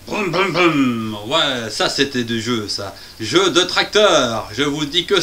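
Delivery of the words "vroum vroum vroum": slow broum broum tractor rumble; playful motor sound; engine noise. Acted.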